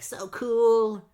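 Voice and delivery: high, loud mock-awed kid; excited kid. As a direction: imitate a kid gushing in awe. Excited kid admiring the exhibit.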